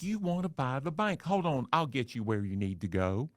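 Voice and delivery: a receptionist cheerfully transferring the odd call; high sugary Southern receptionist; sweet receptionist. Retold or imitated. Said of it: "the sweet Southern Miss Congeniality voice".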